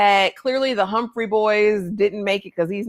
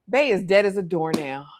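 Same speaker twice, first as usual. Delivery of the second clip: usual speaking voice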